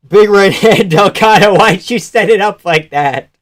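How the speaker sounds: loud, high laughing reaction; excited outburst. Real, not acted. Watcher reacting to big right hand, excited shout.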